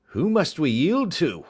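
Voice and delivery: high, loud shouted question; calling back. A narrator's reading. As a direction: call out anxiously across the dark. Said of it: "said Smith again".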